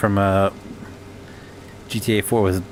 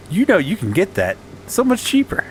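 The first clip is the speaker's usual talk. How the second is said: higher, upbeat sales pitch; salesman pitch; 7 semitones higher than usual. Pretend. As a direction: sell it like a cheesy salesman.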